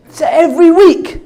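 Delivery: high, loud burst of amazement; hammed-up disbelieving thrill; excited exclamation. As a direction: shout it out, pitch shooting up. Amazed at the weekly pay.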